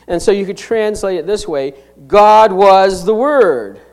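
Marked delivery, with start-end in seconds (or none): 2.1-3.8 slow, loud weight on "God was the Word"; drawn-out, forceful "God was the Word"; emphatic. Shown as a demonstration. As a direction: declare "God" first and firmly, then stretch to "Word". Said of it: "that emphasizes the full deity".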